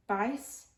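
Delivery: "bice", base said with a long I sound; slow "bice" for base, vowel like eye; broad Australian vowel. Shown as a demonstration. Presenter shows broad Australian base as "bice", with an I vowel. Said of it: "had the sound of I".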